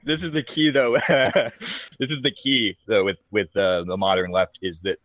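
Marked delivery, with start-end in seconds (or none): 0.0-3.0 higher, chuckling through the words; laughing. Real, not acted. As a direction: get the point out through a laugh. Talking through group's laughter.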